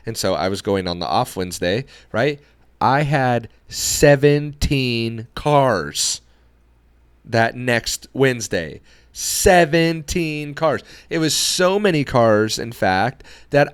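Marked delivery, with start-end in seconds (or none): 2.8-6.2 "seventeen" drawn out very slowly; emphatic big reveal. Real, not acted. Detailer revealing his big haul of cars, slow emphasis on "seventeen". Stressing a surprising big number. 9.1-10.8 "Seventeen" stretched slow and pitched high; emphatic repeat. Real, not acted. Detailer marvelling at his Wednesday workload, high slow stress on "Seventeen".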